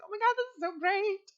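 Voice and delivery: high, squealing delight, retold; giddy excitement. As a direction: gush like everything just fell into place. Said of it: "And I was like".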